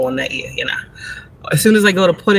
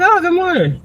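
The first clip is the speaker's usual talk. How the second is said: surprised greeting; 13 semitones higher than usual. Retold or imitated. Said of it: "I'm like".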